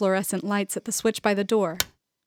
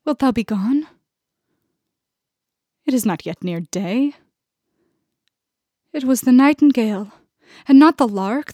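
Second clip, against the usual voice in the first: lightly voiced Juliet; 2 semitones higher, 5 dB louder than usual. A narrator's reading. Janet as Juliet pleading with Romeo to stay, declaimed. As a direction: declaim as Juliet, tender then urgent. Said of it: "as Juliet".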